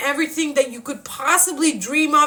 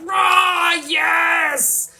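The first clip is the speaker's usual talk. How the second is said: rage roar; 5 semitones higher than usual. Retold or imitated. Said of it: "it turns into rage".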